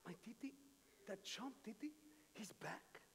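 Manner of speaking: unvoiced, soft contemptuous whisper; bitter whisper. Older son whispering about his returning brother, scornful hush.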